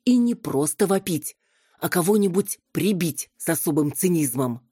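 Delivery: ordinary delivery, nothing marked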